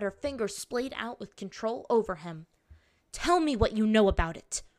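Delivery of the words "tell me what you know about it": louder, forceful snarl of command; snarled order. A narrator's reading. Captor demanding answers from her captive, snarling. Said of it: "Her order came out like a snarl".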